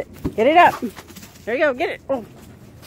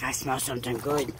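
lower, softer dopey dog voice; dog's voice; 13 dB quieter than usual. Pretend. Owner voicing her sniffing dog in a low dopey voice. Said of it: "He's like".

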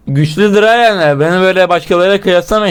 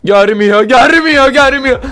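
high, frantic repetition climbing in pitch; rising panic; 6 semitones higher than usual. Real, not acted. Player hiding as Granny gets close, rising panic. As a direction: let the reassurance turn into a cry.

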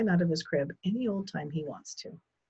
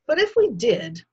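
pompous man; 9 semitones higher, 8 dB louder than usual. Acted. Mr Yarby pompous.

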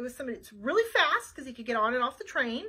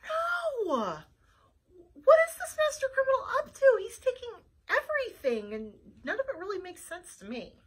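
higher, mildly tearful; dismayed detective; 9 semitones higher than usual. Acted. Flytrap reacting to the kidnapping, dismayed. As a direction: lament the news.

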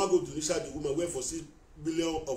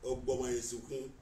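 usual speaking voice

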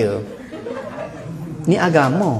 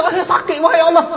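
mock cry of pain; 9 semitones higher than usual. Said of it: "dia kata".

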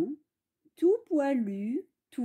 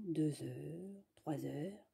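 whispered; 8 semitones lower, 14 dB quieter, much slower than usual. A narrator's reading. Suspense, wolf waiting.

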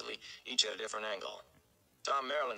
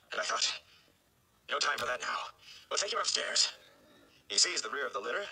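relieved mayor; 6 semitones higher than usual. Acted. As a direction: take charge and hurry everyone. Mayor relieved, then commanding.

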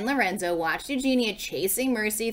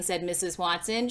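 ordinary delivery, nothing marked

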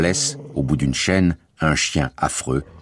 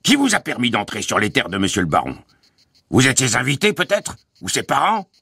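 high, indignant guard's voice; bullying harangue; 7 semitones higher than usual. A narrator's reading. Guard questions family about the baron's land, bullying sarcasm. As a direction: challenge them with rising, sarcastic questions.

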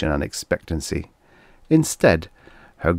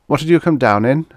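high, louder, abrupt demand; abrupt question; 8 semitones higher, 5 dB louder, faster than usual. A narrator's reading. Guthrie questions the stranded newcomer, abrupt. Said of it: "asked the latter abruptly".

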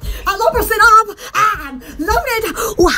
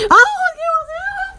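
high hello; 8 semitones higher than usual. Shown as a demonstration. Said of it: "echoing her own sultry hello".

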